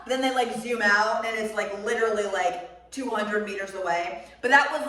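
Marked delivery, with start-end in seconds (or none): none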